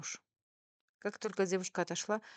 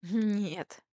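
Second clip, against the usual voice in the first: higher, louder, amused exclamation; amused denial; 4 semitones higher, 7 dB louder than usual. A narrator's reading. Wife answers naive waitress, amused. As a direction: say it as if about to laugh. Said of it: "рассмеялась я".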